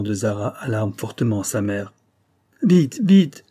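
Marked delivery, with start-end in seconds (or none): 2.6-3.4 much higher, louder frantic plea; pleading. A narrator's reading. Frightened mother begs her daughter for news, high and loud. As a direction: beg them to say it fast. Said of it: "supplie-t-elle".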